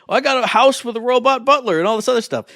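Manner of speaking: high-pitched, hammed-up gloating; boastful quote. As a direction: show off like a lucky player. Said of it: "and I went".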